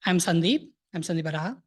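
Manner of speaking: normal talking voice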